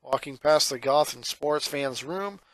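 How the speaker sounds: ordinary delivery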